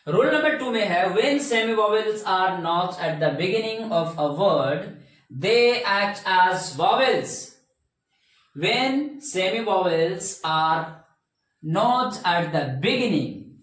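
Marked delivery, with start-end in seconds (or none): 1.1-7.6 very slow, word by word, for note-taking; dictating a grammar rule at a crawl; slow dictation. Shown as a demonstration. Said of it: "teacher dictating rule slowly". 8.5-13.6 very slow, each word spaced out; slow dictation. Shown as a demonstration. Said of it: "repeating rule slowly".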